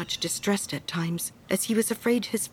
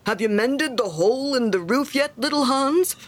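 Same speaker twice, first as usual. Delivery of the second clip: higher, sing-out question rising at the end; cheery; 5 semitones higher, 4 dB louder than usual. A narrator's reading. Miller checking on Hans's work on the roof, cheery. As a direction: call out jovially to a friend. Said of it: "in a cheery voice".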